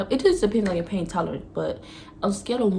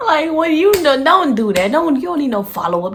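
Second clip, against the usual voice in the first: louder, higher mock lecture; loud playful scolding; 8 semitones higher, 10 dB louder than usual. Real, not acted. Scolding guys who text how you feel, loud and playful. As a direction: tell him off loudly, half laughing. Scolding the guy after laugh.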